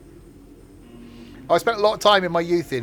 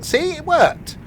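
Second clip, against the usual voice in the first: excited; 5 dB louder, slower than usual. Real, not acted. Excited at chat success.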